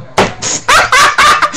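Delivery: sudden high cackle at the absurdity; laughing in disbelief at the manager's answer; high burst of laughter. Real, not acted. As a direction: break into high-pitched laughter.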